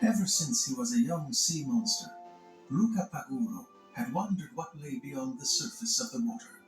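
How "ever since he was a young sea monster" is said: plain reading voice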